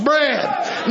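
ordinary delivery

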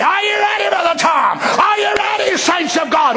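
high ringing call; rousing roll call. Retold or imitated. Jesus calling the saints to battle, rousing. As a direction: rouse the saints. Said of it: "Lord calling roll".

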